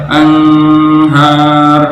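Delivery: sung anhar with hidden n; blurred n mistake. Shown as a demonstration. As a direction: show the wrong anhar, n blurred. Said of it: "nggak boleh". (anhar samar yang salah)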